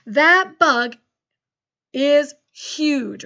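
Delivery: excited dictation. Shown as a demonstration. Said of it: "Okay, listen close".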